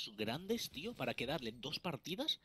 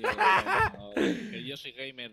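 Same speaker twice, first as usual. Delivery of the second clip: laughing protest; 3 semitones higher, 14 dB louder, much slower than usual. Real, not acted. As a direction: shout it down laughing. Defending himself laughing.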